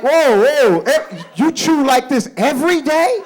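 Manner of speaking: high, drawn-out, disbelieving whoa; slow, wide-eyed reaction; stunned dad. Retold or imitated. Dad reacting to son chewing.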